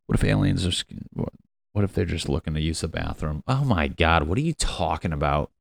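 normal talking voice

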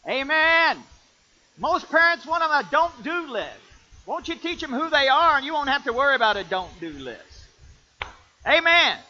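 loud, high call for amen; full-force preaching. Acted. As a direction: rouse the congregation.

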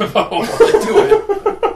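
laughing through the punchline. Real, not acted. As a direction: let the joke crack you up. Crude joke punchline.

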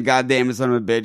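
ordinary talk, nothing marked

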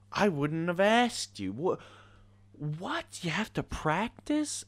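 high, loud mock astonishment; sarcastic disbelief. Real, not acted. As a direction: play at being stunned, loudly.

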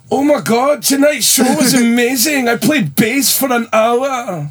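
high, drawn-out mock Scottish accent. Shown as a demonstration. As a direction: gush slowly in hammed-up Scottish. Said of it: "Do your Scottish accent".

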